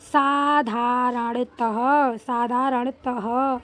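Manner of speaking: साधारणतः drawn out very slowly, the final ह breathed out; slow careful reading. Shown as a demonstration. Teacher reading the word for children, very slow. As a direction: spell out साधारणतः slowly, one syllable at a time. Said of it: "अब इसको कैसे पढ़ेंगे".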